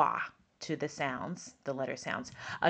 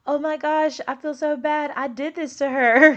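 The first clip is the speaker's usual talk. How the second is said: much higher, louder guilty gasp; dismayed dad; 9 semitones higher, 8 dB louder than usual. Retold or imitated. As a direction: sound like the shocked, guilty dad. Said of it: "the dad was like".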